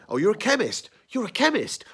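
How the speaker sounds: high, loud hammed-up exclamation; mock overplaying. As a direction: overact it like a bad stage player. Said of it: "they're overplaying".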